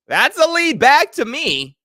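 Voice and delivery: a high, loud, slow insistence; emphatic shout. Real, not acted. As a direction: punch it out like a fed-up fan. Arguing about the running back.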